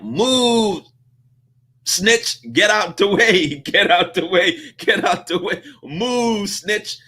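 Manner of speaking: showing how move sounds unfriendly; mock rude shove-off. Shown as a demonstration. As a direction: play the rudest version of move.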